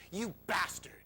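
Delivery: defiant curse at departing dad, acted out; defiant muttered curse. Boy to his father as he leaves, defiant whispery curse. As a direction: let the curse out through a whispery voice.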